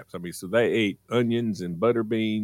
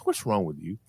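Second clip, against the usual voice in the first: exasperated mama; 11 semitones higher, faster than usual. Retold or imitated.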